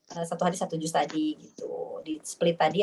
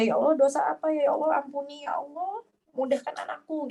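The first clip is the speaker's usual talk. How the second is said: pleading prayer; 7 semitones higher than usual. Retold or imitated. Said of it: "jadi kita tuh ingat ya".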